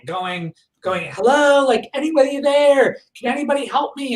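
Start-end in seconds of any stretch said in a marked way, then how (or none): 1.1-4.2 high-pitched call for anyone, not a full scream; retold cry for help. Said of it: "I started screaming, crying, going".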